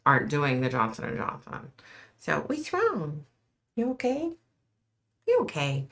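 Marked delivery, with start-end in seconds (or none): 2.2-4.3 high, soft pet voice; soothing the dog. Real, not acted. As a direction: gently ask the scared puppy if she's okay. Soothing her frightened dog.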